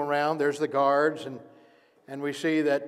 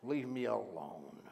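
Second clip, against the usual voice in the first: quiet plea; 6 semitones lower, 14 dB quieter, slower than usual. Acted. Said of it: "very violent and very angry".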